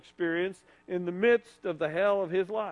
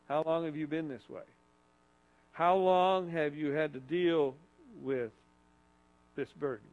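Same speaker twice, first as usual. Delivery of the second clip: softer, quieter questions as Jesus; gentle Jesus voice; 4 semitones lower, 5 dB quieter than usual. Acted. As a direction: ask tenderly, as Jesus would. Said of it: "Let me play Jesus for you".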